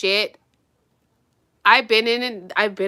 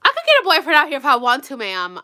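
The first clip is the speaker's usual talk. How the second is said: fast, louder, higher retort; indignant comeback; 4 semitones higher, 4 dB louder than usual. Acted. Patient telling the doctor she can get a boyfriend, indignant. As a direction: burst out in acted indignation.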